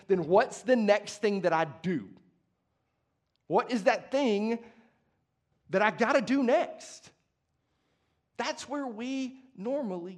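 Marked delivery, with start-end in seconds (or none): 0.1-7.1 louder, higher, urgent asking; anxious pleading question. Retold or imitated. Preacher voicing believers anxiously asking what to do. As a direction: voice the believers pressing for the next rule.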